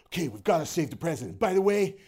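loud, high action-hero line; hammed-up movie hero. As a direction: overact a cheesy movie hero. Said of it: "for no reason just goes".

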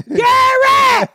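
high, loud yell for backup; calling out. Retold or imitated. Mother yelling for Gary to come help, high shout. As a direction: holler the name across the house.

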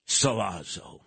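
ordinary talk, nothing marked

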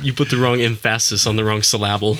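deliberately misplaced stress, em-FASS-is and sil-LAB-ul; Mike Myers. Retold or imitated. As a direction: hit the middle of emphasis and syllable. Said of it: "Mike Myers is like".

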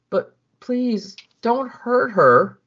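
high, slow, tearful begging; crying plea. Acted. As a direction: choke out the plea through mock tears. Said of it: "He's crying".

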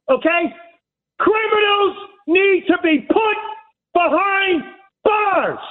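high-pitched angry tirade; real fed-up yelling. Real, not acted. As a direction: rant it in hammered, emphatic phrases. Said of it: "Because I'm fed up".

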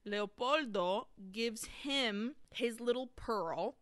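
ordinary talk

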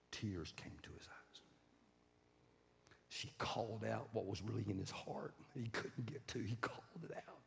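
low, soft, moved narration; quiet, solemn preacher's voice; hushed sermon storytelling. A narrator's reading. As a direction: tell it in a reverent hush.

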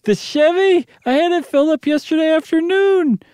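high, fretful housewife voice; a frightened wife pleading; anxious wife voice. Acted. Janet's voice in reading.

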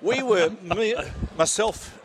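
lightly put-on TV host delivery, halting; a hint of a showy presenter; [name] Host telling a story about dating his wife, light presenter impression. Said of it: "Why are you talking like that".

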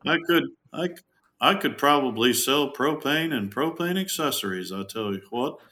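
cartoon propane salesman impression; Hank Hill. Shown as a demonstration. Showing off a favorite impression, in a Hank Hill voice. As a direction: raise it a little into the salesman's catchphrase voice. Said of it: "Hank Hill is one of my favorite ones".

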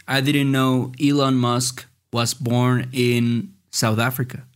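reads the English slowly and clearly; a slow prompt for the students; teacher reading a quiz line slowly. Shown as a demonstration. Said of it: "cómo dices".

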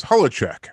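Holacek in three syllables, HOLL-a-check; original surname pronunciation. Shown as a demonstration. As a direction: say Holacek with a middle vowel. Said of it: "his wife's maiden name".

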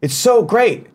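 high, gushing mock praise; sarcastic fan gushing. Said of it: "Everyone's like".

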